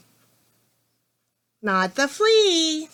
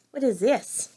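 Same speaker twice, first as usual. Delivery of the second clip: normal talking voice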